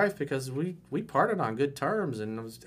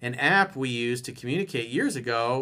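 ordinary talk, nothing marked

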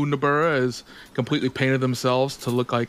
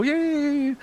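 put-on high-pitched, gleeful child voice; excited little kid; 11 semitones higher, slower than usual. Child delighted the grown-ups are scared, high excited cheering. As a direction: cheer with drawn-out, squealed yays. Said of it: "They're excited. They go".

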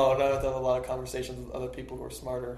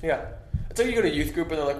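normal talking voice

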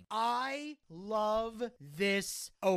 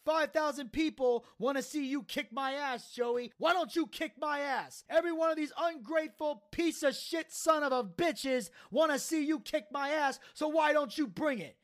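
high-pitched wrestler's goading, retold; passionate promo; 6 semitones higher than usual. Taz dares Joey to kick his ass, passionate promo. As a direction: goad him in a mock promo. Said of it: "Taz coming out with the passionate promo".

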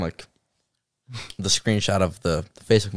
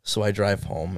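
normal talking voice

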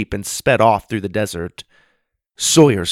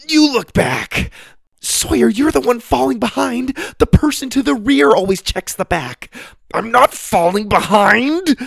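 very high, loud squabbling shouts; panicked bickering; 14 semitones higher, 5 dB louder than usual. Acted. Twins squabbling while they flee the kangaroo, high loud bickering. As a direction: argue in a frantic yell.

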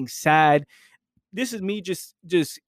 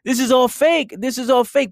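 higher and louder, amused disbelief; amused realization; 9 semitones higher, 4 dB louder than usual. Real, not acted. Watching a crying movie audience, higher and louder amused disbelief. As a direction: burst out with it, half laughing. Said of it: "Yo, people are crying and this is all fake".

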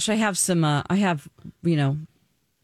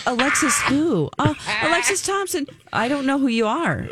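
confused woman; 9 semitones higher than usual. Retold or imitated. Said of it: "And the woman's like".

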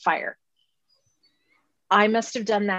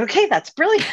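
higher and louder, lightly excited; mock-thrilled at the idea; delighted agreement; 8 semitones higher, 6 dB louder than usual. Replying to her friend's pan suggestion, excited. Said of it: "I said".

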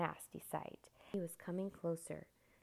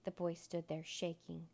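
ordinary delivery, nothing marked

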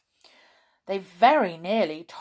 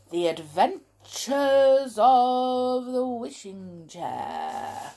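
slow, high, ringing announcement of the title; grand title announcement; 6 semitones higher, 6 dB louder, slower than usual. Shown as a demonstration. Reader to children, announcing the book title grandly. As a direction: proclaim the title like a storyteller. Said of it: "announcing the book title".

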